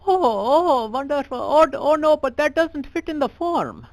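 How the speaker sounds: put-on high, fretful clerk voice in an Indian accent; fussy clerk. Clerk frets the name won't fit the form, high and fussy.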